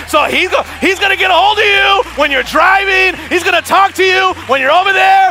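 shouted, high preaching climax; full-force preaching. Acted. Pastor shouting the warning to the congregation. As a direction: build to a shouting sermon peak.

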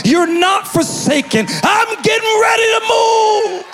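fiery pulpit delivery voicing God; full-force preaching. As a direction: preach it out to the whole room, rising. Preacher building to a climax.

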